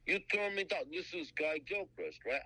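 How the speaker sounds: put-on high, brash New Jersey accent; Jersey guy. Jersey caller confronting the artist, high brash Jersey accent. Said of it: "he talks just like a guy from Jersey".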